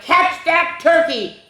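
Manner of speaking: high, hammed-up call to chase; rally the kids after the turkey; a playful read-aloud holler; mock cry. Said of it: "and the cry of".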